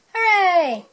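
high, gleeful cheer. A narrator's reading. Class hears it is milk time, cheering.

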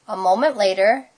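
usual speaking voice

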